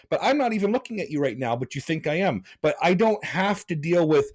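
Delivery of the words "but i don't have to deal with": ordinary talk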